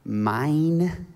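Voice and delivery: mock toddler grabbing; childish mine. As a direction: say it like a greedy little kid. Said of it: "a four-letter word, mine".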